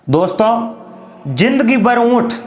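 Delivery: ordinary delivery, nothing marked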